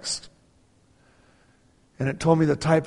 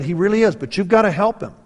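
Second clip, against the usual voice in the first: higher and louder, pressing her; retold plea to the wife, raised; earnest urging; 6 semitones higher, 6 dB louder, faster than usual. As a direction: push the point home.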